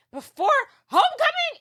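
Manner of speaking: very high, incredulous squeak; hammed-up disbelief at being dumped; mock shock. Girlfriend dumped before the dance, shrieky mock disbelief.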